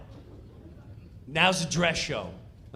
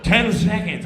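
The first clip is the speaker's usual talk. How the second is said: countdown call; 4 semitones higher, 7 dB louder than usual. Retold or imitated.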